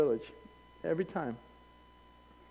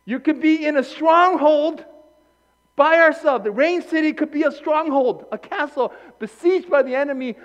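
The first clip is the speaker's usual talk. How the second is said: normal talking voice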